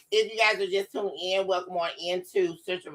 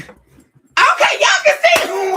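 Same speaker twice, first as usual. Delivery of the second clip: much higher and louder, bursting with joy; excited shout; 10 dB louder, slower than usual. Real, not acted. Telling viewers they can finally see her, excited shout. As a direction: cheer that it finally works.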